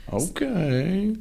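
drawn out, rising high; sceptical okay. Real, not acted. Reacting to the co-host's odd story, high dubious drawl. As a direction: stretch it into a dubious question.

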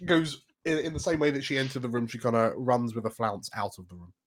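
normal talking voice

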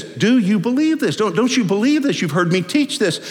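high, pressing questions; insistent questioning. Acted. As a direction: urgently press the listener to believe. Jesus pressing Mary with questions.

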